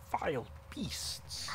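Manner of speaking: slow, hushed whisper of contempt; whispered disgust. Acted. Paladin whispers contempt for the kobold beasts. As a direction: breathe out the words in loathing.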